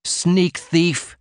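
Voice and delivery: a sharp retort, pitched high. A narrator's reading. Martin flinging the insult back at the manager. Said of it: "Martin retorted".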